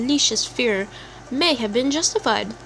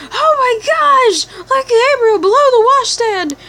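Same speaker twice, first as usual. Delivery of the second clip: panicked shriek; 13 semitones higher, 6 dB louder than usual. Acted. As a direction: shriek it out as if you've seen a zombie. Character panics at discovery.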